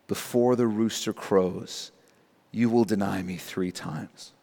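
low, soft, solemn quote; grave recollection. Retold or imitated. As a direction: recall the prophecy quietly and heavily. Peter remembers the prediction, grave reading.

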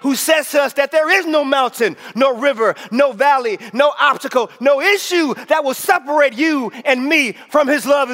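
high, emphatic preacher's delivery; full-force preaching. Real, not acted. Preacher sharing song lyrics with the congregation, full-force preaching.

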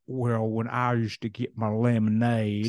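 slow put-on Southern drawl; leisurely mock-Southern storyteller; Southern accent. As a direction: stretch it out in a hammy Southern accent. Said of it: "That was my Southern accent".

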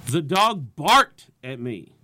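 slow line with "barked" punched loud; slow down and hit "barked" hard; stress on BARKED. Shown as a demonstration. Comedian varying the joke's key word, stress on "barked". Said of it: "Pick a different word in your jokes to put inflection on".

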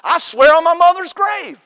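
high, insistent mock kid's vow; childlike oath. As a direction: swear it like a protesting kid. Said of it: "did you ever say it this way?".

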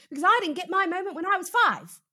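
half-joking choked-up rant; a touch of fake tears, played for laughs; mock emotional outburst. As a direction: ham up the old childhood wound. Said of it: "Sorry, I don't mean to get emotional".

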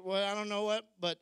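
ordinary talk

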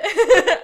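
loud, high burst of laughter; delighted laugh. Real, not acted. Co-host tickled by the love story notes, delighted laugh.

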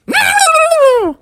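very high, loud cartoon shriek; startled fairy squeal. Tinkerbell grabbed by Froggy's tongue, high loud startled shriek. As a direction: squeal as if snatched. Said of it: "Tinkerbell said".

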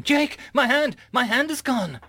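very high-pitched cry of horror; panicked scream. A narrator's reading. Mum whose hand is vanishing, screaming. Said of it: "she started to scream".

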